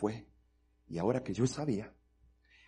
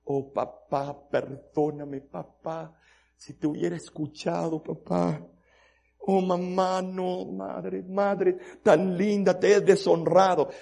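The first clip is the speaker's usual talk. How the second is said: mild retold crying; 8 semitones higher, 8 dB louder, slower than usual. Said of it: "empieza a llorar... a gritar".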